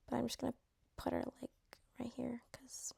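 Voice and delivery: hushed whisper aside. Real, not acted. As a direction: whisper it like a secret placement. Quietly placing her in ranking.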